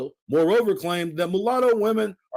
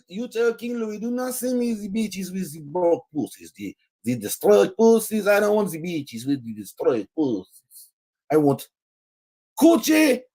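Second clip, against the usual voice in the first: hammed-up French accent, higher and breathless; mock French accent; 6 semitones higher than usual. Host mocking the governor's letter to King Louis in a comic French accent. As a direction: play the French colonial governor in a silly French accent. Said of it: "this is my French accent".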